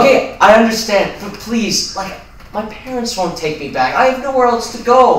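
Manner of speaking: loud, high-pitched pleading; desperate begging. Acted. Roommate with nowhere to go, begging desperately. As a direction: beg as if nowhere is left to go.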